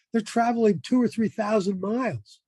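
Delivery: ordinary delivery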